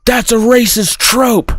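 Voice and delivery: high-pitched mock indignation; accusing wife. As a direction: declare it like an offended wife. Acted wife accusing husband.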